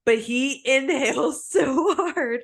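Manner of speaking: high, drawn out through real laughter; laughing. Real, not acted. Wife retelling how hard he inhaled, helpless laughter. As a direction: struggle to speak while cracking up.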